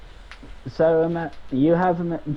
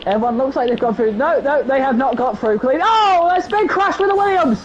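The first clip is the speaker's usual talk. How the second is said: excited; 11 semitones higher, 6 dB louder than usual. Real, not acted. Calling a first-lap crash.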